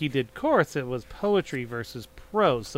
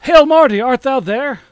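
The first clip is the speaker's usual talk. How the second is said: grand, theatrical verse reading, higher and louder; mock Shakespearean reading; 4 semitones higher, 5 dB louder than usual. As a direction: declaim it like an old stage actor doing Shakespeare. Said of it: "In, in iambic pentameter, please".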